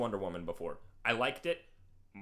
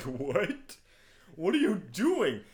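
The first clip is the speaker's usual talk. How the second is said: incredulous, rising to high and loud; mock disbelief; 6 semitones higher, 5 dB louder, slower than usual. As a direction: question the fool in exasperation. Reacting to character who stops evacuating.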